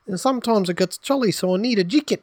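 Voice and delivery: very high-pitched New Zealand caricature; mock Kiwi accent. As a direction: put on a squeaky exaggerated Kiwi accent.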